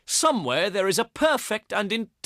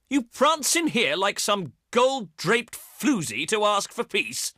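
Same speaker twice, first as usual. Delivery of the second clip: ordinary talk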